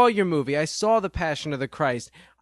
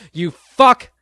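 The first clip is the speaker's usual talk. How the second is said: sudden furious yell, much louder; angry shout; 4 semitones higher, 11 dB louder than usual. Acted. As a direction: blurt out a loud angry curse at him. Rant peaks at Mel.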